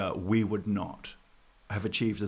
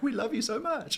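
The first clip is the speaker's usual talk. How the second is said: very high, soft cooing; doting big sibling; 13 semitones higher, 3 dB quieter than usual. Retold or imitated. Kiwi voicing Australia as a doting older sibling, high coo. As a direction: coo at them like a baby. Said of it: "Australia's like".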